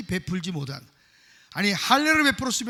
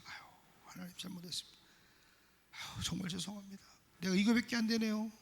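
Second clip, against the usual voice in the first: hushed prayer, much softer; quiet repentant prayer; 8 dB quieter than usual. Retold or imitated. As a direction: confess softly to God. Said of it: "감동해서". (하나님께 잘못을 고백하며 훨씬 작고 조용히)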